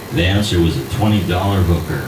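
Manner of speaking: plain reading voice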